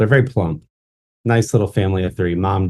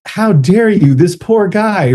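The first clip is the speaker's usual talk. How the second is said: mock scolding; 10 semitones higher, 5 dB louder than usual. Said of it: "they're like".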